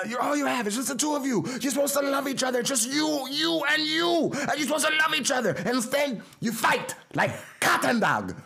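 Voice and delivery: put-on very high, emphatic Belizean-accented rebuke; dad's Belizean accent. Dad scolding fighting kids, high Belizean-accented rant. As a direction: lecture loudly in a high-pitched Belizean accent. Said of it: "impression of Dad".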